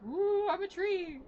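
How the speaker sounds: floaty voice. Acted. Dead lady possessing a tree for fun, floaty voice. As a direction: float it out, high and drawn-out, like a playful spook. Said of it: "like, floaty voice go".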